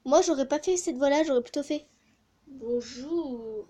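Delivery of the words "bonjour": low, soft monster growl of a greeting; a hint of a monster saying hello. Shown as a demonstration. She shows the voice she would have done, low soft monster voice. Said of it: "ça c'est plutôt la voix des monstres".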